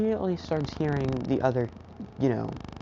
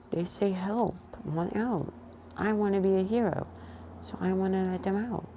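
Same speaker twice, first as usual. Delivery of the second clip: put-on high, halting little kid voice; toddler voice; 6 semitones higher than usual. Toddler Izuku telling his dad what happened, halting kid voice. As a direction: talk like a small child in broken words.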